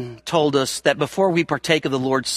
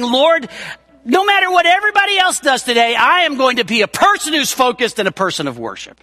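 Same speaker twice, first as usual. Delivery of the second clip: fervent declaration; 8 semitones higher than usual. Pretend. Worshipper declaring devotion to the Lord. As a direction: swear it out with passion. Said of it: "putting my heart out there and saying".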